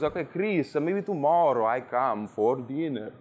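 a hint of the visiting Italian friend inviting himself; Italian guest imitated. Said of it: "And he goes".

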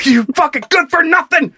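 high-pitched play-acted tirade; hammed-up angry yell; mock screaming. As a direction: hurl a pretend insult at a car. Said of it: "Go scream at it".

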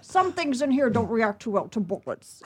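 rough Scottish action-star impression; Sean Connery. Shown as a demonstration. Audition piece she admits is weak, Scottish star impression. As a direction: imitate a famous Scottish actor's burr. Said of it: "I did Sean Connery".

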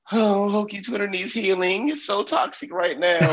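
high, whiny mock complaint. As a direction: sneer it like a fussy complainer. Mocking complainers on Twitter.

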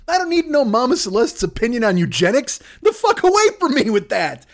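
high, prissy put-on voice; mock shocked gossip. Busybody hears about Mama Celeste, high mock shock. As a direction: gasp it out like a scandalised busybody.

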